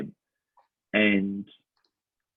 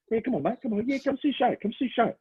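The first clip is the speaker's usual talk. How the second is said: higher, cheeky hawker pitch; Kings Cross spruiker; 14 semitones higher, 3 dB quieter, faster than usual. Retold or imitated. Touting a clown show to passersby, cheeky spruiker pitch. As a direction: sell the show like an eighties Kings Cross tout. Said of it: "like the guys at the Cross in the eighties".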